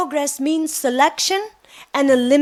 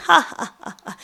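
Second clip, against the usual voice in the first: imitating her young carefree laugh; a spelled-out mock ha-ha; retold laughter; faster than usual. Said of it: "And I was laughing".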